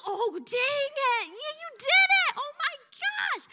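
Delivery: very high, loud squeal of amazement; acted amazement. Realizing God did it that very day, acted amazement. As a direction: re-enact being blown away, shrieking it high.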